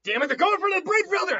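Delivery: urgent high, loud yell; acted alarmed battle shout; Naruto. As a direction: shout a frantic warning mid-battle. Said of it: "Naruto yells".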